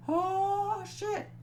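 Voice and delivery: a yelp of sudden dismay; shocked oh shit. Retold or imitated. Danny thrown off the bridge, in a squeaky shocked yelp.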